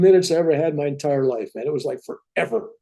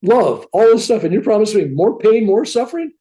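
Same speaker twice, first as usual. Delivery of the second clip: indignant protest; 9 semitones higher, 4 dB louder than usual. Retold or imitated. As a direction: push back in disbelief, pitched high. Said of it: "I s-, I said".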